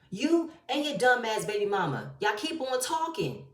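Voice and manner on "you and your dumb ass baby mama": ordinary talk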